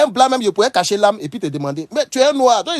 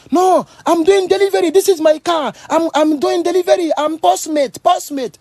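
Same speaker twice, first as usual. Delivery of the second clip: high, pleading delivery man's voice; scared delivery man; 11 semitones higher, slower than usual. Retold or imitated. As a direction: plead you're just delivering. Livreur qui s'explique effrayé. (livreur apeuré, voix aiguë)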